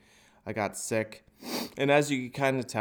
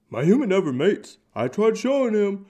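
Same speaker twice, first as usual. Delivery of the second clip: high, loud dog complaint; 8 semitones higher, 7 dB louder than usual. Retold or imitated. Dog telling on its owner, high loud whine. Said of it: "dogs would be like".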